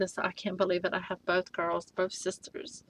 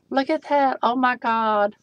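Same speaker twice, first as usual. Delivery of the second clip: usual speaking voice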